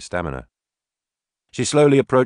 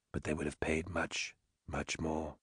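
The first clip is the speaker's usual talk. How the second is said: much softer, hushed; whisper; 2 semitones lower, 17 dB quieter than usual. Acted. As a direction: breathe it close to her ear. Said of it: "she said in a whisper".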